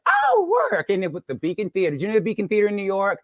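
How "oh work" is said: oh swooping way up; mock impressed at his job; high impressed oh. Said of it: "I was like".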